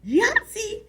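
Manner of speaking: sudden high, loud shout; excited yell. Acted. Bobby Jean yells from behind the couch, high and loud. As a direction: blurt it out like a kid who wants something. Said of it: "Bobby Jean yelled".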